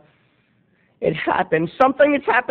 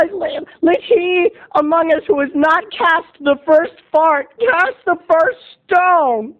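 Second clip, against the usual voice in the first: mock preacher; 8 semitones higher than usual. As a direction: preach it like a prophet to the class. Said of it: "And I said".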